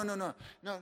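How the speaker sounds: normal talking voice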